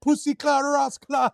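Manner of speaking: high, punchy imitation of Jamaican patois; mock patois. Podcaster imitating his friend talking to a customer, mock Jamaican patois. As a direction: put on an exaggerated Jamaican patois. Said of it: "He starts speaking like patois".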